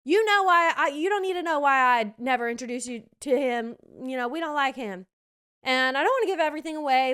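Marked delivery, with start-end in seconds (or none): 0.0-5.1 put-on loud country mama accent; firm mother with a country twang. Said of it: "my country accent".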